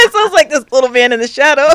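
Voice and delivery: loud, high, laughter-filled words; laughing. Real, not acted. Storyteller joking about her short dad, laughing as she talks. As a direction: push the line out through real laughter.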